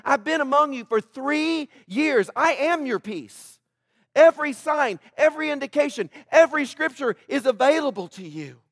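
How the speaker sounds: Jesus pleading with Jerusalem, preached high and loud; pleading preaching. Acted. Preacher voicing Jesus, full force.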